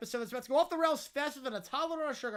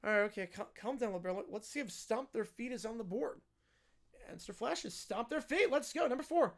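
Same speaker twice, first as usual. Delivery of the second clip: ordinary talk, nothing marked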